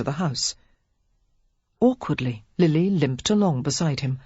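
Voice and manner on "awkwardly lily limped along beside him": usual speaking voice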